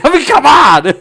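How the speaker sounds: real exasperation breaking into a laugh; exasperated laughing shout. Real, not acted. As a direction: throw it out with an incredulous laugh. Outraged laugh at defense.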